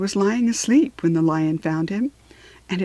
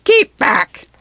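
loud, higher-pitched rough warning; rough cry; 4 semitones higher, 6 dB louder than usual. A narrator's reading. Unseen guard warning travelers off the hill, rough loud cry. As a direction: cry it out roughly to halt them. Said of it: "heard a rough voice cry out".

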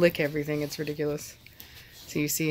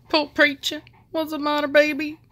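high cooing pity; 8 semitones higher, 3 dB louder than usual. Real, not acted. Owner pitying her rejected male dog, high coo.

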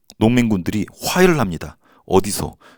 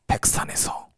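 whisper; 10 dB quieter, slower than usual. Acted. Answering own question in a whisper.

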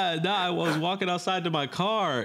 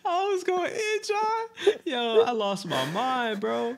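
high excited; 11 semitones higher, slower than usual. Retold or imitated. Retelling losing his mind.

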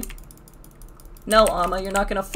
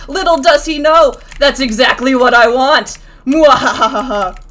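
loud mock-villain gloat into evil laugh; 9 dB louder than usual. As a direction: crow it like a hammed-up villain.

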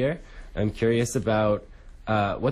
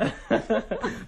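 laugh; 11 semitones higher than usual. Real, not acted. Laughing at old photos.

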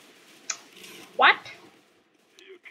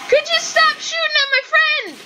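high, pleading yell; outraged high protest; exasperated yell; 10 semitones higher than usual. Real, not acted. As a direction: demand it of the enemy at the top of the range. Yelling at enemy shooting teammate.

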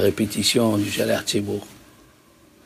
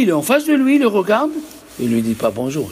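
normal talking voice

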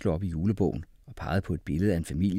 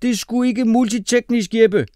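put-on very high, loud boy's voice; squealed, indignant protest; 11 dB louder than usual. A narrator's reading. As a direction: object at the top of your pitch. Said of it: "protesterede Mohammed".

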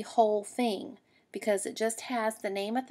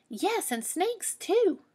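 put-on much higher, slow scared kid; little rabbit warning about snakes; high slow child voice; 10 semitones higher, 4 dB louder, much slower than usual. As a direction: draw it out like a nervous bunny.

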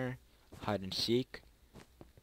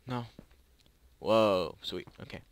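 usual speaking voice

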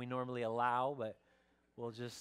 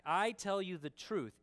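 "I" pitched high and punched; emphatic scripture quote; 5 semitones higher, 3 dB louder than usual. Acted. Pastor repeats Jesus' words to show their emphasis, emphatic. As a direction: hit "I" firmly, then drop on "truth". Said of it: "making it emphatic".

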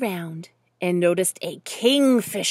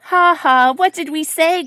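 loud, higher mock laugh and taunt; mocking cry; 6 semitones higher, 8 dB louder than usual. A narrator's reading. Other ducks laugh at Duffel, mocking cry. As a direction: crow at his failure, loud and jeering. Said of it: "cried the other ducks".

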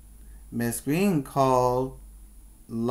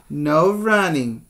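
firmer, louder no running; lightly stern teacher; 3 semitones higher, 7 dB louder than usual. Acted. Miss Green repeating the rule to Ben, stern. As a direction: lay down the rule to the boy. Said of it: "Miss Green says".

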